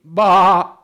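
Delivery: high, loud mock baa; sheep bleat. Preacher listing animal defenses, sheep just bleats.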